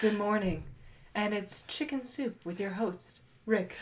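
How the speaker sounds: soft, hushed radio host voice; NPR host. Pretend. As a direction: murmur it like public radio. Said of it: "like a Saturday morning NPR radio show".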